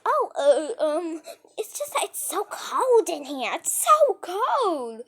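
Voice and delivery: hesitant start, then a high, loud, drawn-out whine; acted nervous excuse. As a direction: stammer out a hammed-up excuse. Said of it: "that scared me so much".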